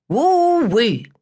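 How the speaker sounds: high, delighted whoop; excited exclamation. Acted. Shady Green whooping at the music's end. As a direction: let out a joyful woo-wee. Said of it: "exclaims Shady Green".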